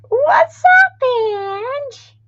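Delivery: excited high-pitched hello to a friend; high squeal greeting. Real, not acted. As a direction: squeal it out to a friend who just joined. Greeting a viewer in chat.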